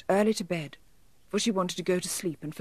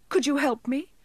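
tearful elf; 7 semitones higher than usual. A narrator's reading. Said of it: "asked the elf, drying his eyes".

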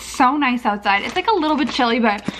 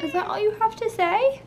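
pet talk; 11 semitones higher than usual. Real, not acted. Teasing the cat.

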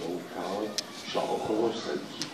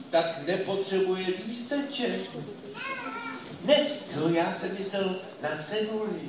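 excited father; 9 semitones higher than usual. Acted. Father plans to send the talking dog for beer. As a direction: bubble over with a bright idea.

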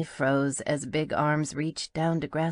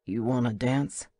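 low male voice; 3 semitones lower than usual. A narrator's reading. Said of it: "Boone asked".

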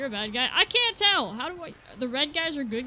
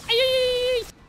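higher, louder imitated yelps; mock scream; 11 semitones higher, 8 dB louder than usual. Mocking the companion's scream, high loud yelps. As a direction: imitate a silly panicked scream. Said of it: "Why did you just scream like that?".

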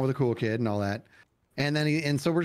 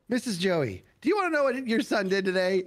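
high, sing-out tattletale voice; retold tattle, pitched up; tattling; 7 semitones higher than usual. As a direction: play a kid telling on a friend. Retold tattling at the dinner table.

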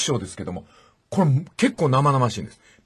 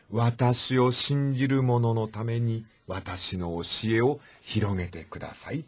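softer, slower, hushed words of Jesus; quiet and gentle; 3 semitones lower, 6 dB quieter, slower than usual. Retold or imitated. Jesus quietly answering Peter, softer and slow. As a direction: speak it gently, slowly, barely above a breath. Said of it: "主は静かに".

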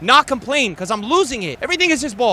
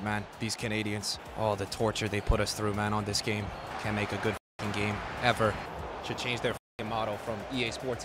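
very low, soft, menacing grumble; quiet seething; 12 semitones lower, 10 dB quieter than usual. Real, not acted. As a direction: vow revenge low and under your breath. Gamer seethes with vows of payback.

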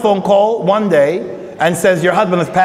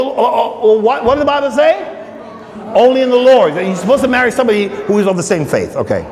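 ordinary talk

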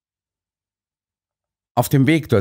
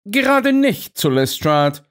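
stern Holmes, pitched much higher; firmly correcting Watson in a raised voice; 10 semitones higher than usual. A narrator's reading. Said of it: "erwiderte Holmes streng". (Holmes streng zurechtweisend)

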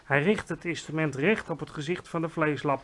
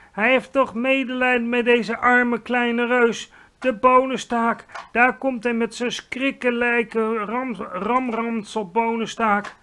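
high, loud, frightened pleading; screeching; 6 semitones higher, 7 dB louder than usual. A narrator's reading. Giant dreads Jacob's beanstalk, high screeching plea. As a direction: wail in dread, at full voice. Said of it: "krijste de vleeslapeter".

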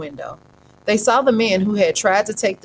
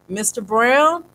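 calling; 5 semitones higher, 4 dB louder, slower than usual. A narrator's reading. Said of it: "he called".

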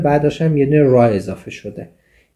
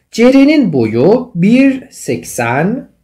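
slow reading; 8 semitones higher, 4 dB louder, much slower than usual. Shown as a demonstration. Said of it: "teacher reads example sentence slowly".

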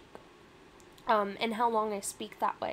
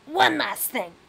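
loud, aggressive Rat King; 4 semitones higher, 10 dB louder, slower than usual. Acted. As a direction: throw it out forcefully as the rat. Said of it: "that is an aggressive voice".

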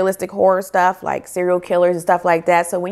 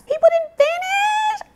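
much higher, slow incredulous squeak; shocked; slower than usual. Real, not acted. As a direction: stretch it out in disbelief. Shocked by many DNF reviews.

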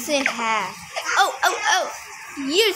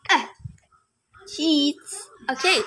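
ordinary talk, nothing marked

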